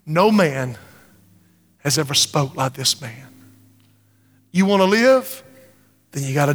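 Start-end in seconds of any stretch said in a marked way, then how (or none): none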